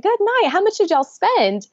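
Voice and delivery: high teasing question; joking. Retold or imitated. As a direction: tease him about the cost. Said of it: "I kind of made a joke".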